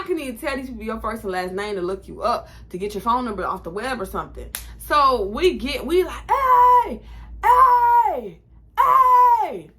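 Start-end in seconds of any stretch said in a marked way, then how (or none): 6.3-9.7 high, loud yell from afar; shouting. Retold or imitated. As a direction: call hey after the bus. Calling after the bus.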